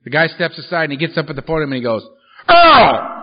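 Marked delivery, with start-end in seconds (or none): 2.5-3.1 loud high shout of a name; calling out loud. Retold or imitated. Sponsor at the podium calls him out in the meeting: loud shout. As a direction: call the name across the room.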